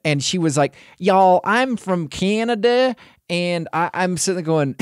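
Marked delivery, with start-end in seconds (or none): 1.0-2.9 high, drawn-out put-on North American accent; mock North American accent. Reviewer mimics the Canadian character, mock North American accent. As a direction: imitate her annoying North American accent, higher and slow. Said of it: "her accent annoyed me the entire time".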